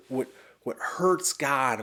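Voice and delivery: usual speaking voice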